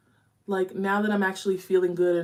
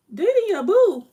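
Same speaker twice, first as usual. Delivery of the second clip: high, loud, teasing sing-song; playful high-pitched tease; teasing squeal; 14 semitones higher, 10 dB louder than usual. Real, not acted. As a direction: tease the viewer about their boo. Teasing reply to comment.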